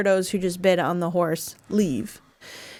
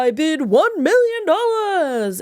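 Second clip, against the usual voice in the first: high, showy, lightly voiced bidder; flamboyant man; 9 semitones higher, 4 dB louder than usual. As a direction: camp it up a little. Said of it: "this little flamboyant man walks in".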